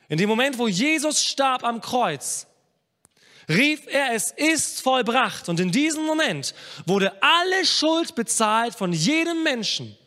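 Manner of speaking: loud, high proclamation with drawn-out key words; full-force preaching. Acted. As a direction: proclaim it triumphantly to the whole congregation.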